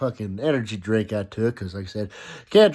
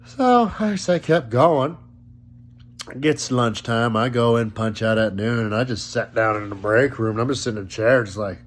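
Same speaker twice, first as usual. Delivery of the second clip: ordinary delivery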